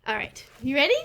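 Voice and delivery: plain reading voice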